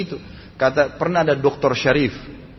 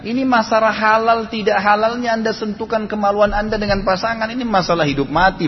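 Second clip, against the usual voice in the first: forceful preaching; 7 semitones higher than usual. Acted.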